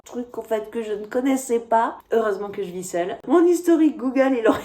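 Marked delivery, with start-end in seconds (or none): 3.3-4.6 laughing. Real, not acted. Laughs about her own search history.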